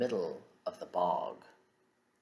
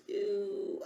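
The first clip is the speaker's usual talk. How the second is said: disgusted ew. Acted. Disgusted reaction in rhyme.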